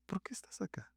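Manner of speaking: breathy whispered question. Acted. God whispers to the hiding prophet, hushed. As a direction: lean in and whisper it.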